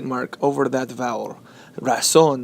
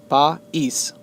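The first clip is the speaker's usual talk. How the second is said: país split as pa, ÍS; two separate syllables. Shown as a demonstration. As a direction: say país in two beats, stressed on ís. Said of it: "dividing it into two, two syllables".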